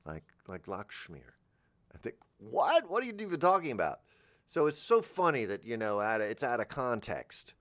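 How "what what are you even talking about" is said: high, fast mock incredulity; baffled listener. Baffled listener challenging the speaker, high mock disbelief. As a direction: blurt it out quick and pitched up. Baffled reply in acted exchange.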